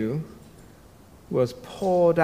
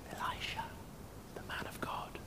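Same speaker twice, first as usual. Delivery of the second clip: whisper; 25 dB quieter than usual. Acted. Dramatic answer to why.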